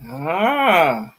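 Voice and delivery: sudden cry of pain. Real, not acted. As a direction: yelp like a stubbed toe. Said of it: "your sound effects are really, uh, scary".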